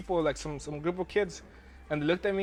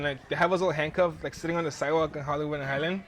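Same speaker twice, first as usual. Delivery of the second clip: usual speaking voice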